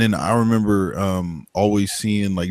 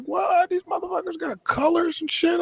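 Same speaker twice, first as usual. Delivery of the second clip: much higher, stunned exclaiming; high-pitched mock shock; amazed disbelief; 4 dB quieter than usual. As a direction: squeak it out in total disbelief. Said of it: "I was like".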